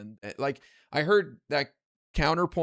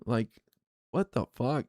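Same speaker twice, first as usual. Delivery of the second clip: ordinary delivery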